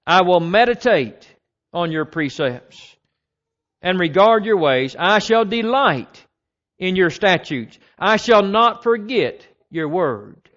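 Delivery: loud, measured psalm reading; scripture reading. Acted. Preacher reading Psalm 119 to the congregation, loud and measured. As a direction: proclaim each verse slowly. Reads psalm verses aloud.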